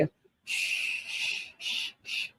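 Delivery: unvoiced shh sounds, like a breeze; a hushed shh drifting away; soft shushing whoosh. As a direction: breathe it out as a gentle whoosh. Whooshing people aside effortlessly.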